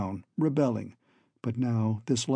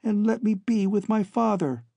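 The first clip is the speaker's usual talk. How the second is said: put-on higher young woman's plea; pleading daughter; 8 semitones higher than usual. A narrator's reading. Daughter begs captor to let her join father, pleading. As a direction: beg in a higher, earnest voice. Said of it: "She called".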